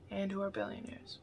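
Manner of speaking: quiet echo. Shown as a demonstration.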